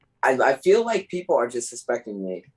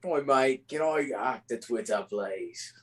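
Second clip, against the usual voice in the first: put-on British accent, trailing off softer; Ridge Holland; 6 dB quieter than usual. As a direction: try a British regional accent. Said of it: "getting a bit of Ridge Holland vibe".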